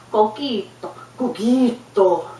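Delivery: put-on slow "Coquito" in a Spanish accent. Relative showing the difference, "Coquito" in a Spanish accent. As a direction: model "Coquito" with pure Spanish vowels, stretched. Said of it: "the beautiful Spanish accent".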